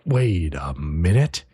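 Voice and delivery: low, slow, drawn-out realization; retold dawning suspicion. Hero realizes who the tribe is, slowly suspicious. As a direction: stretch it low as the hero catches on.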